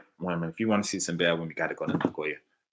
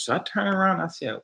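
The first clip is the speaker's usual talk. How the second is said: ordinary talk, nothing marked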